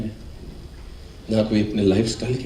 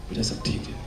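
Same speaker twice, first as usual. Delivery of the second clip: hushed reply; 9 dB quieter than usual. Retold or imitated. Father's quiet reassurance.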